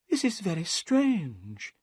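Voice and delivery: slow, high and uneasy; a hint of worry creeping in; nervous. A narrator's reading. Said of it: "Thaddeus Sholto said nervously".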